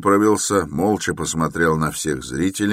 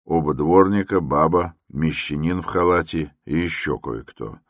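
plain reading voice

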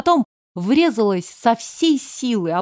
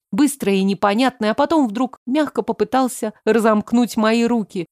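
ordinary delivery

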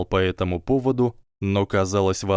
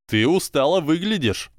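higher, brighter teasing twin voice; cheerful twin; 8 semitones higher, 3 dB louder than usual. A narrator's reading. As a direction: cheerfully tease him.

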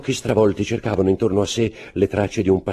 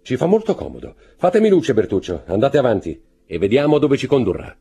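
raised, louder count giving orders; higher, firm order to a servant; commanding count; 7 semitones higher, 6 dB louder than usual. A narrator's reading. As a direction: issue it as a master's order. Count's dialogue in narration.

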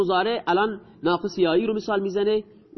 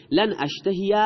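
ordinary talk, nothing marked

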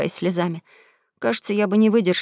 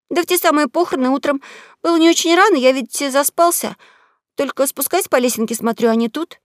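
put-on higher, eager boy protesting; pleading young boy, pitched up; boy's voice; 6 semitones higher than usual. Huck keeps explaining.

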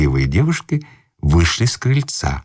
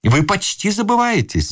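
desperate cry; 9 semitones higher than usual. A narrator's reading. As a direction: cry out at his wits' end. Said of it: "закричал ... в отчаянии".

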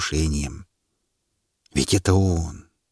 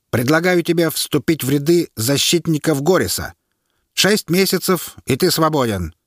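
higher, firm soldierly offer; officer's offer; 7 semitones higher than usual. A narrator's reading. The officer offers the prisoner a way out, firm and higher. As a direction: press him to decide.

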